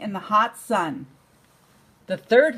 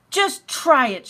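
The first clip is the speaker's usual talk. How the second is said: louder, higher dare; defiant shout; 5 semitones higher, 5 dB louder than usual. Acted. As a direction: throw the challenge back at him. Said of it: "shouted the third little javelina".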